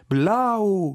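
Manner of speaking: loud, high German "Blau!" blurted out; correcting the French with a loud German "Blau!"; Orlik bursts out. Acted. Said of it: "saltò su Orlik, scoppiando a ridere fragorosamente".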